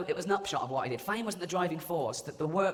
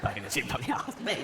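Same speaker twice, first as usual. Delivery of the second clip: low start rising into a questioning squeak; incredulous echo; 4 semitones lower than usual. Acted. Patient repeats doctor's shocking diagnosis, incredulous. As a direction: repeat it in mock disbelief, pitch climbing.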